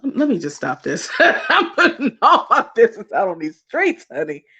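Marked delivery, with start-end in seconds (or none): none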